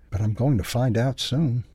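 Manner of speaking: plain reading voice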